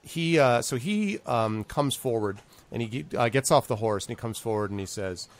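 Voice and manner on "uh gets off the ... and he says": ordinary talk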